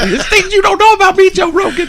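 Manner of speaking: very high, squealing crackhead character; Tyrone Biggums. Acted. Sketch addict confessing to a podcast host, high squealing character voice. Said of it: "or the Tyrone Biggums guy".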